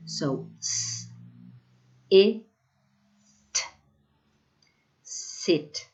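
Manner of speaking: "s, i, t" each sound said apart, then blended into "sit"; sounding out words. Shown as a demonstration. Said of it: "first we segment it and then we blend".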